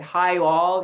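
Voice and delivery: loud, friendly Texan "Hi all"; Texan accent. Retold or imitated. Speaker imitating Brené Brown's Texan greeting to a room, in a loud Texan accent. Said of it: "in her beautiful Texan accent".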